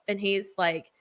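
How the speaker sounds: normal talking voice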